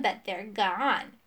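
slow, drawn-out mock sorrow; mocking imitation. A narrator's reading. Rival mimics the magician's lost tears, mockingly. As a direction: stretch it scornfully as a parody.

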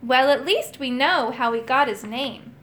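ordinary delivery, nothing marked

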